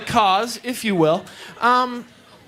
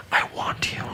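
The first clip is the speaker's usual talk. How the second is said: breathy whispered "I want you"; 5 dB quieter than usual. Retold or imitated. Girl whispering to him while they kiss, hushed whisper. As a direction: whisper it close to his ear. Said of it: "she whispers, she goes".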